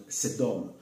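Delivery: "Sedom" as "sèd-DOM", not Frenchified; non-Frenchified pronunciation. Shown as a demonstration. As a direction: say "Sedom" Hebrew-style with an open è. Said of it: "c'était pas francisé". (« Sedom » non francisé, « sèd-dom »)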